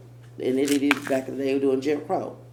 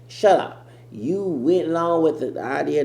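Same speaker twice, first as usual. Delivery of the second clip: mock scolding; 5 semitones higher, 8 dB louder than usual. Scolding the woman he imitated.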